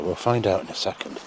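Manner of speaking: ordinary talk, nothing marked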